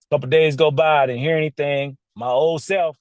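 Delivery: ordinary talk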